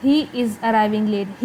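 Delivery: slow, focus on "He", tone falling on "arriving late"; falling statement. Shown as a demonstration. Teacher demonstrating a statement with a falling tone. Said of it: "He पे focus हो रहा है और arriving late पे मेरी tone नीचे जा रही है".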